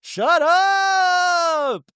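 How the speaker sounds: very high, sustained shriek of disbelief; mock astonishment. Showing how Mary literally said it, mock astonishment. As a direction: stretch the last word out in a high squeal. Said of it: "she did literally".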